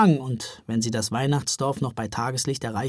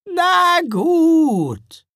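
high, loud, drawn-out concession; grudging grumble; 14 semitones higher, 8 dB louder than usual. A narrator's reading. Troll mother giving in to the Santas, grumbling. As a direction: give in grudgingly. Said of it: "brummte Gaia".

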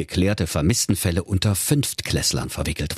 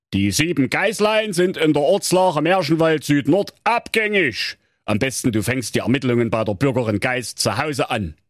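gruff police commissioner; 7 semitones higher than usual. Acted. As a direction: play the weary commissioner. Commissioner answers.

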